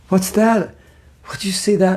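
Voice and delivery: high, eager fake alarm; mock distraction. Devotee tricking a friend before a sweet, mock alarm. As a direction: point at something to distract them.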